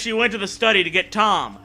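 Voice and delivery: ordinary talk, nothing marked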